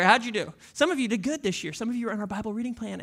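ordinary delivery

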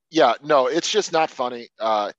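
ordinary talk

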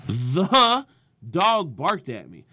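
"The" punched loud and held apart; stress on THE. Shown as a demonstration. Comedian demonstrating a new inflection each set, stress on "The". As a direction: lean hard on "The", then finish the line normally. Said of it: "Pick a different word in your jokes to put inflection on".

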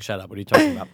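ordinary delivery

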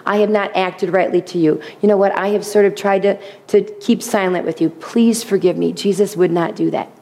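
usual speaking voice

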